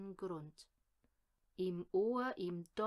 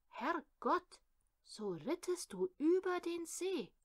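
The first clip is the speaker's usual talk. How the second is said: high-pitched exclamation of amazement; astonished cry; 8 semitones higher than usual. Acted. Maid to the rider who crossed the frozen lake, astonished cry. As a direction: cry out in awe, pitch leaping up.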